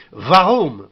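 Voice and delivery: "Warum" starting with a v sound; German w as v. Shown as a demonstration. Teacher demonstrating the German letter W, crisp v onset. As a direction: say "Warum" as "varoum". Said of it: "En allemand, c'est toujours V".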